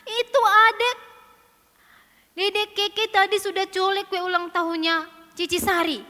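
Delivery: high, loud, whiny accusation; tattling child. Acted. Sister blaming Keke to Mama, loud whine. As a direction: whine as you tell on them.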